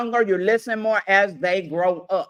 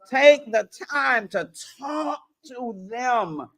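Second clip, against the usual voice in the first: ordinary talk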